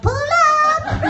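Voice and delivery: high shouted pull up; mock shout. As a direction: yelp it like a command. Mock command while raising hands.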